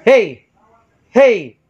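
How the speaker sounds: short, high shouted hey. Shown as a demonstration. Sound tech shouting into the mic for the meter. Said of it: "Sisigaw ako".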